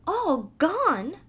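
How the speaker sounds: mouse cries out the name high; a shocked little question; surprised cry. Acted. Mouse hearing the last name, surprised cry. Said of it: "cried the mouse".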